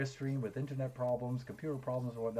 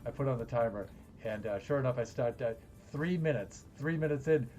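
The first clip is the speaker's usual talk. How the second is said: normal talking voice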